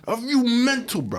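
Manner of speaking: very high, loud London roadman; mock London accent. The actor confronting the director, in a mock London accent.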